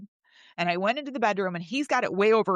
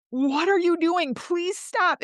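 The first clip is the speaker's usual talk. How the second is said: high, exasperated begging; retold desperate plea; 10 semitones higher, 4 dB louder, slower than usual. Woman begging him to stop during a struggle, high retold plea. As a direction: implore him in a raised voice.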